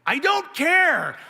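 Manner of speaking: high, loud, stretched-out mock yell; defiant outburst. Pouting husband answering his wife, defiant outburst. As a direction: blurt it back in hammed-up defiance.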